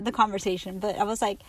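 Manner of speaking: ordinary talk, nothing marked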